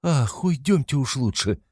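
high, drawn-out, anxious plea; a touch of fright, slow and high; panicked mother. A narrator's reading. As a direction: urge them away, mildly alarmed. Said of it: "перепугалась мать".

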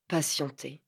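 ruminated word. A narrator's reading. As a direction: murmur it as a bitter thought. Mot détesté répété. (mot ruminé plus bas et plus doux)

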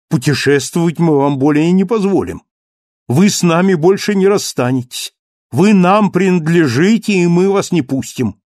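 loud, high, firm insistence; impassioned Marius. Acted. Marius refuses to let him go.

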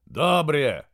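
loud, high and hearty. A narrator's reading. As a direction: call it out boldly. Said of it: "громко произнёс он".

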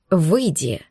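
low growled order. Acted. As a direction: growl it at him. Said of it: "рыкнул Шантар на друга".

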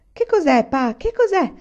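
put-on high, loud, eager child's questions; thrilled, insistent cry to a parent; excited shriek. A narrator's reading. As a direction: squeal the question, all worked up. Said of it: "strillò Carrie, tutta eccitata".

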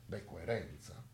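ordinary talk

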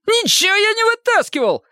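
very high, squealing protest; squealed denial. A narrator's reading. Detainee denying he took the dumplings, high-pitched squeal. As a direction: shriek it thinly, indignant. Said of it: "взвизгнул Лысый".

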